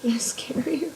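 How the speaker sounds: teasing whisper. Acted. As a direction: whisper it playfully. Teasing after spooky greeting.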